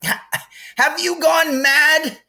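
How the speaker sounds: very high, loud, slow bellow; mock bellow. A narrator's reading. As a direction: bellow at her in disbelief. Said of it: "Ron bellowed".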